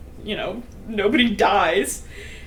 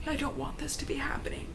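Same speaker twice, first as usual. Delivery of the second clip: soft secretive whisper; whispering a secret to an ally; 3 semitones higher, 13 dB quieter than usual. Retold or imitated. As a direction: lean in and breathe the words. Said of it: "kinda whispers to Fireheart".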